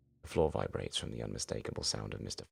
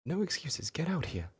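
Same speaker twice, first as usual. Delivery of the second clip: much higher, fussy and scolding; high-pitched teacher demanding answers; 4 dB louder than usual. Acted. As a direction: flap at them like a flustered teacher. Scalar orders Ben.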